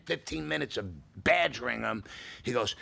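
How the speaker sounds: ordinary talk, nothing marked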